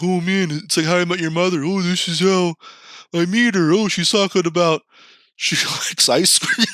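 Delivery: mock starry-eyed excitement; hopeful inner voice. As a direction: gush like you've found the one.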